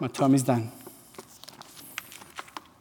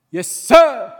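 sharp, loud eager reply; loud yes sir; 6 semitones higher, 14 dB louder than usual. Retold or imitated. Preacher voicing a servant's ready reply, loud. Retold servant answering loudly.

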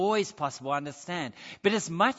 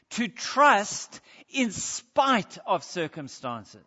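louder, driving preacher's build; rising sermon climax; 5 dB louder than usual. Acted. Preacher urging the church to trust God, forceful build. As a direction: press the point home to the congregation.

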